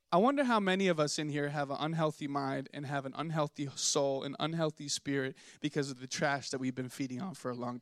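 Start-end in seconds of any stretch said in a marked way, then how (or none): none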